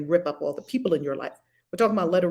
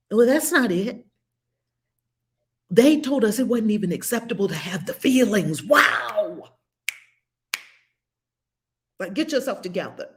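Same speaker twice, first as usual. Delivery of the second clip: ordinary talk, nothing marked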